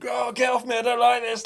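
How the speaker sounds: high, frightened protest; a wounded soldier panicking as medics cut off his clothes. Retold or imitated. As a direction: push them away in a panic. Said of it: "I just remember panicking".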